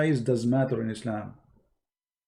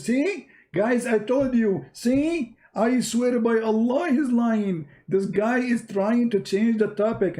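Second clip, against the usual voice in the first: much higher, slow, triumphant mock accusation; excited sheikh; 8 semitones higher, slower than usual. Sheikh tells the audience the debater is lying, high and gloating. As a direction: crow to the audience that he's lying. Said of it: "He get more excited".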